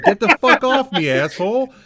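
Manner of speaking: loud, high-pitched mock fury; indignant shout, reliving the game fight; exasperated retold yell. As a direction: yell it like shaking off an attacker. Acting out fighting off zombie.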